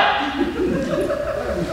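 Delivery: high breathless giggle at his own parody; soft high laugh after mocking the preacher. Real, not acted.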